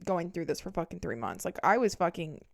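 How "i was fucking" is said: ordinary delivery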